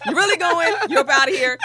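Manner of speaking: doctor sending patient to the hole, laughing; mock outrage. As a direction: shout him off to the hole. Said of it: "I was like".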